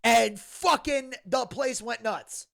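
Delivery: ordinary delivery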